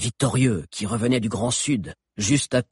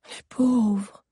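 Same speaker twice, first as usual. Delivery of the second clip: higher voice, full of pity; a lightly voiced girl sighing in pity; 10 semitones higher than usual. A narrator's reading. Sabi watches the captives pass, pitying.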